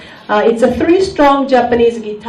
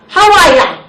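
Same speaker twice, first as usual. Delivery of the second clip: loud, high-pitched official's greeting; imitated officer, raised voice; immigration officer; 6 semitones higher, 6 dB louder than usual. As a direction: call it out like a brisk Aussie officer. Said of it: "the immigration officer said".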